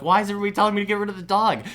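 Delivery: high, loud, rushed protest; mock-exasperated outburst, voice shooting up; exasperated retold plea. Retells exasperated plea from stressful dream.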